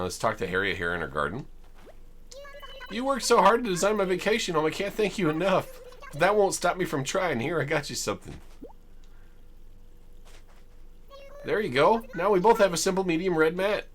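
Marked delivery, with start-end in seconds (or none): 2.9-13.9 high-pitched, thankful villager voice; high character voice for a grateful villager; grateful game villager. Acted. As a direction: voice Harriet up high, gushing thanks. Reads Harriet's game dialogue aloud.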